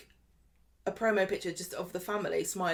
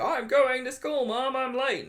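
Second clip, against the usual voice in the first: imitating an American TV teen, drawn out and a bit higher; mock American accent; 5 semitones higher, slower than usual. Teasing a cliché American breakfast scene in a put-on American accent. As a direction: ham up a US sitcom kid rushing off to school. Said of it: "It's a brilliant accent".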